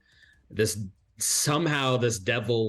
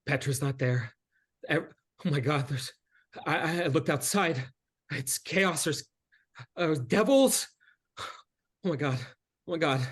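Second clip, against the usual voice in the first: a touch of panic, higher; lightly voiced frightened girl; 4 semitones higher than usual. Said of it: "and she says".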